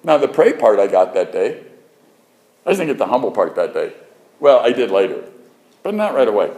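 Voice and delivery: ordinary talk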